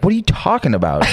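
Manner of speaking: playing dumb. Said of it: "I was just like".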